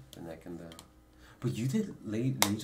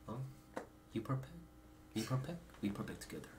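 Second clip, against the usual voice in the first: put-on Korean accent; 3 semitones higher, 13 dB quieter than usual. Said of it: "In, in Korean accent?".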